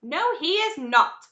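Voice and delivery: high, loud, slow protest; emphatic rebuttal. Acted. Grandpa Joe defends Wonka against the parents, emphatic and loud. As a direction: stretch each word in firm defence.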